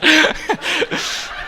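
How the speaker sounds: high, bright burst of real laughter. Real, not acted. Laughing at the awkward retreat with friends. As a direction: laugh out loud at the memory.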